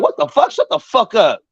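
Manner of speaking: high, indignant mock yell; re-enacted argument, pitch jumping up; retold angry comeback. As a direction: snap back in acted outrage.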